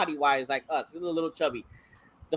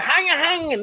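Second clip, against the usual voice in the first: mock German; 9 semitones higher than usual. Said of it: "He like".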